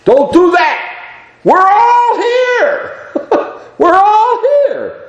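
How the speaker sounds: very high, drawn-out shout; crying out. Acted. Paul stopping the guard from killing himself, urgent cry. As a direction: call out slowly across a prison to stop someone. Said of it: "Paul cries out".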